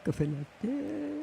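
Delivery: Café latte drawn out very slowly and high; slow copy. Shown as a demonstration. Learner repeating her friend's French, very slow.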